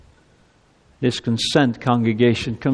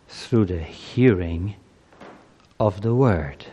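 closing prayer; 4 semitones lower than usual. Acted.

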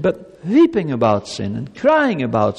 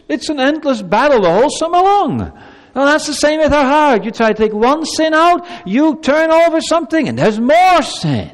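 loud, higher preaching voice, driving the point; forceful preaching; 7 semitones higher, 5 dB louder than usual. Acted. Pastor urging children about sin, forceful preaching. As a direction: preach it hard to the congregation.

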